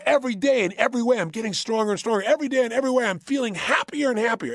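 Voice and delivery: chanted, upbeat and rising; sing-song affirmation, pushed; incantation demo. Shown as a demonstration. Coach modelling a daily affirmation, chanted.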